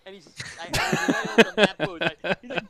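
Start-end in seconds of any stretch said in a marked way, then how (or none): none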